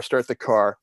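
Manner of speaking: ordinary delivery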